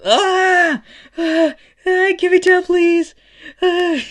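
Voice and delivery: very high, loud shrieks; acted terror, squealed at full pitch; panicked screaming. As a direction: scream and beg as you dangle in midair. Kid dangling in the air, screaming.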